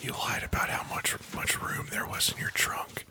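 soft unvoiced whisper close to the mic; hushed ASMR performance. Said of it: "A S M R in here".

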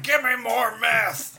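high, slow crazed bum voice; mock crazed bum. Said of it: "Then he was like".

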